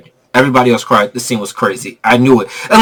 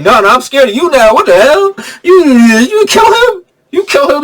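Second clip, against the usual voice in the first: mock scared; 10 semitones higher than usual. Sandman scared of Spider-Man.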